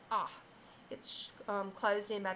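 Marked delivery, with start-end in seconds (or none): 0.1-0.3 "ah" as the short O; short O sound. Shown as a demonstration. Teacher contrasting the closed O for children, short sound. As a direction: give the short O as "ah". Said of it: "this O was short".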